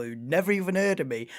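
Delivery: ordinary talk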